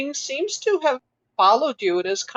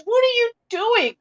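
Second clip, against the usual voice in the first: curious ranger, much higher; 11 semitones higher than usual. Acted. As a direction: pitch it up as a puzzled young woman. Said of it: "and says".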